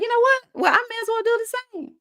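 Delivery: high-pitched retold inner voice; acted resolve. Woman retelling her decision to speak up, acted high-pitched resolve. Acting out her past change of mind.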